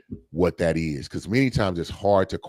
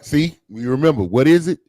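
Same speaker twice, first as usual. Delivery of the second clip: ordinary talk, nothing marked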